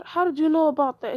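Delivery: loud, high startled question. Acted. Caught out about the team rule, loud startled question.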